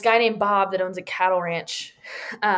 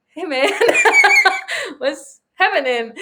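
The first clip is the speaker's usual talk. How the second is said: laughing, high and loud; laughing quote; 9 semitones higher, 9 dB louder than usual. Retold or imitated. Rancher asking a weeping friend what's wrong, laughing. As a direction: laugh through Bob's line. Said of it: "Bob is like".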